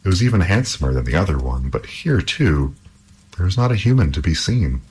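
ordinary delivery

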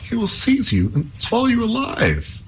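very high, fearful princess; frightened princess. Acted. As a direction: warn him in alarm as a princess. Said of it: "said the princess".

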